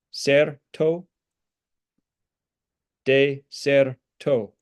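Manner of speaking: "de ser to" split slowly into syllables. Shown as a demonstration. Teacher splitting a Latin word for students. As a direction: spell out "de ser to" one slow syllable at a time. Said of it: "put the stress on ser".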